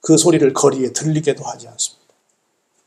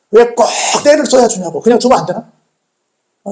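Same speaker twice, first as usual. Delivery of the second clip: usual speaking voice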